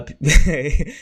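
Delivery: short helpless laugh at the bad day; a short laugh that's all you can do; real rueful laugh. Real, not acted. Streamer to chat after his stream dropped, laughing. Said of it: "I'm just freaking laughing".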